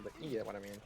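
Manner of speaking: usual speaking voice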